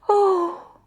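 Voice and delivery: put-on high, young girl's wish; a high girlish wish to herself; wistful girl. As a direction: sigh it like a longing child. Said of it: "she thought".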